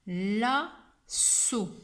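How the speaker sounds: "Lasso" with held l, a gap, long s; split syllables. Shown as a demonstration. Teacher dictates two syllables, "Lasso" split and stretched. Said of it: "deux syllabes".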